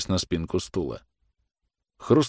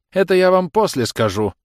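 high, louder, pointed courtesy; spitefully polite; 12 semitones higher, 6 dB louder than usual. A narrator's reading. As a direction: put malice into the polite reply. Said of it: "со злой вежливостью ответил офицер".

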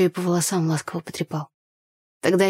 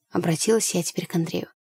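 ordinary delivery, nothing marked

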